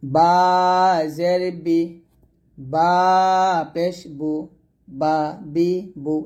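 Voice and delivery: long বা, pause before each joined syllable; slow, separated বা, বি, বু; spelling-out drill. Shown as a demonstration. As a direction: intone বা, then spell it out. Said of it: "এরকম".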